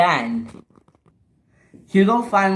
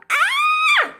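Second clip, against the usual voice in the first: extremely high squealed scream. Acted. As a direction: shriek "ah" way up high. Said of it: "she screamed".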